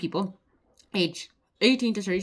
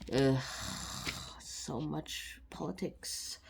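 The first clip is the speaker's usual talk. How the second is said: very quiet, drawn-out weary sigh; weary aside; 5 semitones lower, 9 dB quieter, much slower than usual. Real, not acted. Presenter reacting to the apology row, weary aside. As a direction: groan it softly and slowly, fed up.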